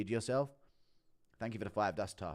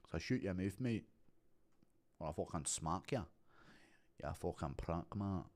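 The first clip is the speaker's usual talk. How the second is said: low, soft Scottish threat; Scottish accent; 6 semitones lower, 7 dB quieter than usual. Shown as a demonstration. Showing a viewer how it differs, low Scottish accent. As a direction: threaten lower and softer in Scottish. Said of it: "a Scottish accent's a bit more like that".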